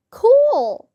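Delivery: high, excited-sounding mock shout. A narrator's reading. Whole class reacts to the villain, acted shout. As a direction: call it out high, gliding down. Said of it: "we all shouted".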